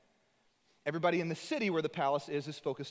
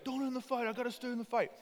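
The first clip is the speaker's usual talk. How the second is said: higher strained Rocky; Rocky pleading; 9 semitones higher than usual. Retold or imitated. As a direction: plead through the pain. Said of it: "He's like".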